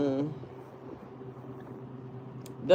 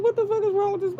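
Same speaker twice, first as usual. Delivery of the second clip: much higher baffled mock voice; imagined onlookers; much faster than usual. Courtroom strangers staring at her crying, high mock disbelief. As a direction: squeal it like a stunned stranger. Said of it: "they'd be like".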